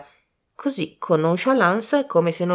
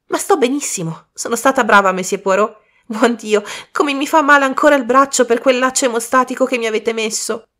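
lively; 6 semitones higher, 4 dB louder than usual. Acted. Bridget laughing, lively reply.

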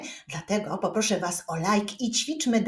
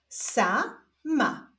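stretched first syllable, pause, then second; drawn-out, divided syllables; syllable reading; 5 semitones higher than usual. Shown as a demonstration. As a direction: read it slowly as two separate syllables. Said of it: "zapraszam Was do odczytywania sylab".

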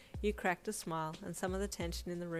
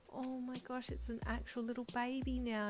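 higher, breathless delight; excited gasp; 7 semitones higher than usual. A narrator's reading. Mother sees her baby on the screen, gasping with excitement. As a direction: gasp it out in wonder. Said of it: "you gasped with excitement".

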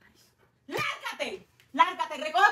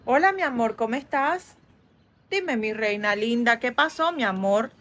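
tender; 9 semitones lower than usual. Acted. Mother soothes daughter on phone.

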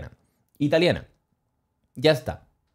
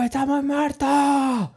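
much higher, slow, stretched-out imitated shout; mock drunk yell; 12 semitones higher, much slower than usual. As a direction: howl it like the drunk guy in the airport. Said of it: "a gritar".

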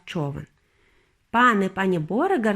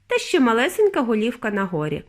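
usual speaking voice

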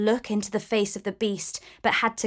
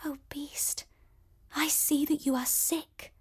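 soft worried voice; 4 semitones higher, 8 dB quieter than usual. A narrator's reading. Belle worries over beast.

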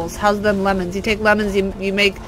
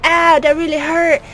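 lightly voiced wounded dragon, pitched up; higher, mock-pained retelling; mock hurt dragon; 6 semitones higher, 3 dB louder than usual. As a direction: imitate the dragon complaining of pain. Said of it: "And he was like".

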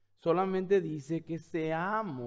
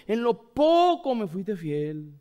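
slow and louder, leaning on "poco"; slow emphatic quote; 3 semitones higher, 7 dB louder, much slower than usual. Acted. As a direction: draw out "En lo poco". Quoting the parable slowly. (cita lenta y enfática)

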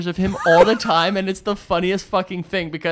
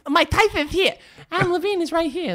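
higher lisping voice, tongue between the teeth; mock Mike Tyson lisp; 10 semitones higher than usual. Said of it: "You sound like Mike Tyson".

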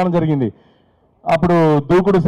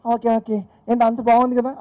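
madam voice; 4 semitones higher, 4 dB quieter than usual. Retold or imitated. Wife chatting to attendant.

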